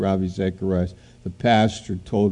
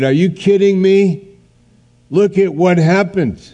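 loud, much higher disbelief; are you kidding me, raised in outrage; incredulous; 9 semitones higher, 5 dB louder than usual. Acted. Preacher voicing doubt about the tragedy, incredulous and loud.

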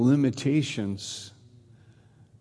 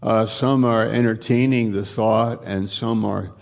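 prayer; 3 semitones lower than usual. Acted. Pastor leading the congregation in closing prayer, hushed and reverent. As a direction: pray aloud, gently and unhurried.

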